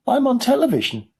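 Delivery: put-on very high, tiny boy; very small voice. A narrator's reading. Shrunken boy on the screen, very small voice. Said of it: "in a very small voice".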